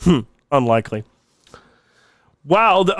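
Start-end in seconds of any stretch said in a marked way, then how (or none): none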